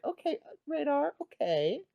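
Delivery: high-pitched, teasing smile in the voice; amused, indulgent sing-song up high; smiling approval. Retold or imitated. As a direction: draw it out high, humouring a kid. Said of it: "like, smiling".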